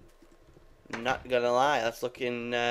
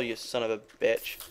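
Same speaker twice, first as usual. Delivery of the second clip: ordinary delivery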